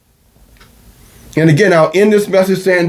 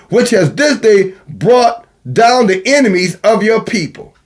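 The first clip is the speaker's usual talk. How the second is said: raised, ringing scripture recital; scripture reading; 4 semitones higher than usual. Acted. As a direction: read it out like holy writ.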